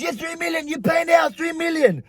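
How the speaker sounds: high mimicked demand for money; imitated pushy driver; insistent demand. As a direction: insist on payment in a high mock voice. Acting the driver demanding payment.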